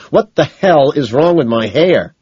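loud, high, slow angry demand; rage. A narrator's reading. Man demanding answers about his hair, enraged. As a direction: hammer out the question in a rage. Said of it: "my rage quadruples".

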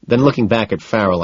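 plain reading voice

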